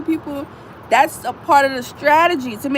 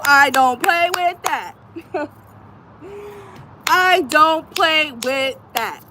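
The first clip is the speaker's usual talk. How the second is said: slow, deliberate, louder repeat; slow and firm; 2 semitones higher, 5 dB louder, much slower than usual. Real, not acted. Mother repeating she won't tolerate harm to kids, slow and firm. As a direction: drag each word out, final.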